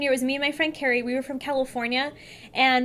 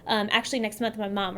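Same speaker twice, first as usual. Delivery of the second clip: normal talking voice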